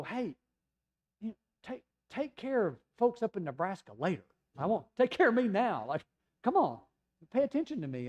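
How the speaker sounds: high-pitched mock pestering; hammed-up, exasperated insistence; impatient demand. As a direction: demand attention like a neglected child. Acting own impatient demands to God.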